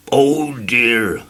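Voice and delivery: gentle, kindly sigh; kindly tramp to fainted man. Acted. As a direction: soothe him kindly. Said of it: "for he was a kindly tramp".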